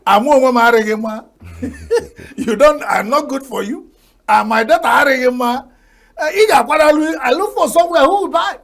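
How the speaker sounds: laughing through a lightly voiced Owerri woman; acting the Owerri woman. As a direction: play the woman with a giggle, only a hint of character. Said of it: "regular story of the Owerri woman".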